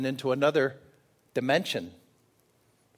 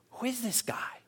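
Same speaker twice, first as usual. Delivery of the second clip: hushed whisper, higher; retold whisper; 9 semitones higher, 9 dB quieter than usual. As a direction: whisper it in awe, wondering. Disciples wondering on the road.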